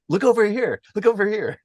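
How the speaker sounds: high-pitched mock call to look away; mock attention-grabbing. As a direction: pitch it way up, playfully diverting the eye. Acting out an artist diverting attention.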